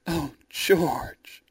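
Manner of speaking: whispered, swooning sigh of a name; breathy whisper. A narrator's reading. Rosalind in George's arms, a whispered swoon. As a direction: whisper it melting into his arms.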